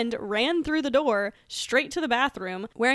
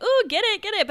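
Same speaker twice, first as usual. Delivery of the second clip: higher, louder playful cheer; cheering tease; 11 semitones higher, 6 dB louder than usual. Retold or imitated. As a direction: egg her on playfully. Cheering friend on at dance.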